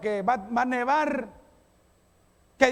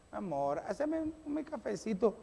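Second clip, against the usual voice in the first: sweet request; 8 dB quieter than usual. Pretend. Sweet husband asking for coffee.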